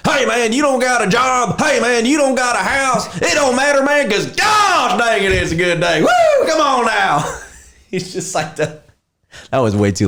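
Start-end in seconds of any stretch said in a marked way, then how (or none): 0.1-7.2 positive country guy. Retold or imitated. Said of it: "He'd be like".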